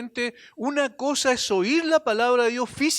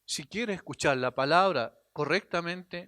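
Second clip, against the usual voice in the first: ordinary delivery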